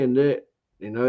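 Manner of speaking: usual speaking voice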